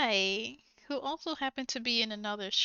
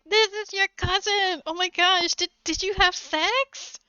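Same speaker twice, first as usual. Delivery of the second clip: higher, louder shocked outburst; imitating a scandalized aunt; shocked lady; 6 semitones higher, 4 dB louder than usual. Aunt at the door gasps at cousins dating. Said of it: "the lady behind the door is like".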